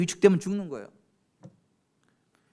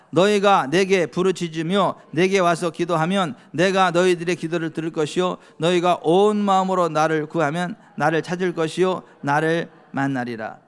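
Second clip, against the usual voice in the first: louder formal scripture reading; preacher reading Hebrews aloud; 2 semitones lower, 6 dB louder than usual. Acted. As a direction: proclaim the verse to the congregation.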